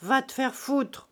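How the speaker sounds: put-on a drunkard's grand, loud brush-off; drunken majesty. A narrator's reading. A drunk glaring at his hostess, loud and grand. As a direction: lord it over her, drunk. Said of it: "d'un air majestueux d'ivrogne".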